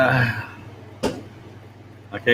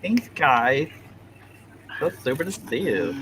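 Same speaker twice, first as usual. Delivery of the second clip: cheerleader voice; 5 semitones higher, 6 dB quieter than usual. Shown as a demonstration. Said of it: "That's my cheerleader voice".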